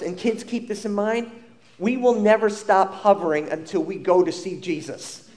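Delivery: ordinary talk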